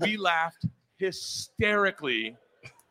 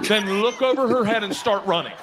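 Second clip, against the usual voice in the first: ordinary delivery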